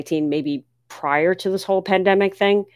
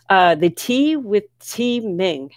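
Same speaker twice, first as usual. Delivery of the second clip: ordinary delivery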